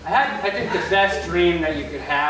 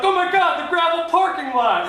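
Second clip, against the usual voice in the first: mock horrified local; 10 semitones higher than usual. Shore resident mourning the parking lot, high mock horror. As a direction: play it as a scandalized shore resident. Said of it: "Someone walked out here and was like".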